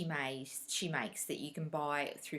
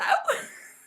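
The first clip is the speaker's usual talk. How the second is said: breathy high laugh; 11 dB louder than usual. Real, not acted. Laughing at herself.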